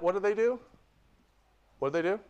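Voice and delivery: ordinary talk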